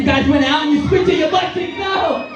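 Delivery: mock-panicked rising yell, climbing high into a shriek; panicked scream. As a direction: squeal in fake terror as you drop out of the plane. Imagined skydive gone wrong.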